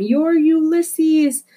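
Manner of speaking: firm reassurance. Acted. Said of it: "she answered him".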